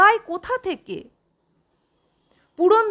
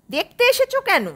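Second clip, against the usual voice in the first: sharply higher, suspicious demand; a mother turning hostile; suspicious retort; 8 semitones higher, faster than usual. Acted. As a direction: throw the question back at them. Mother turns on her children.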